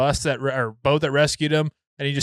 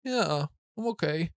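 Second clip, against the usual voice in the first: put-on sick little boy; 3 semitones higher, 5 dB quieter than usual. Voices his sick little boy answering.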